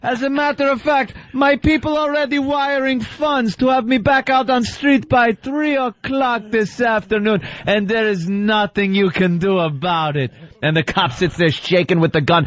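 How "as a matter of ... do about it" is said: high, smug movie villain taunt; foreign bad guy speech. Mock villain taunting the cop at gunpoint. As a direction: gloat like a cornered foreign villain. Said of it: "the bad guy starts his speech".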